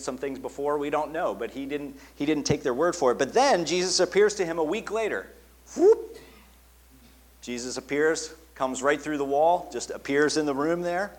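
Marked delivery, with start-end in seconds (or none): 5.8-6.2 whooping sound effect. Real, not acted. Jesus appearing through wall.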